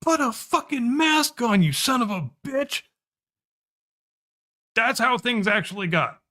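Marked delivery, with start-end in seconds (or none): 0.0-2.8 high, strained imitation of a screamed order; retold scream. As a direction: imitate an irate driver screaming, pitched way up. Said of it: "screams at me".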